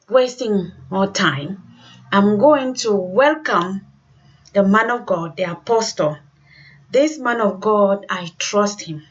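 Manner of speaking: usual speaking voice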